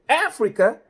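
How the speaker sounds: high, loud incredulous "Africa?"; astonished trooper. Retold or imitated. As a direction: squawk it in disbelief.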